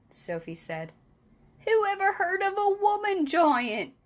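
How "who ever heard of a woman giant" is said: much higher, louder shout. A narrator's reading. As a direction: bellow it out in outrage. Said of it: "shouted the B F G".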